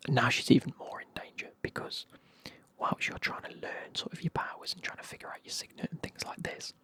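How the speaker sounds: usual speaking voice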